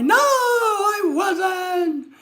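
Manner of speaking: high, very slow, whining fake cry; mock whiny denial. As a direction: whine it like a caught troll. Voicing the troll denying yelling.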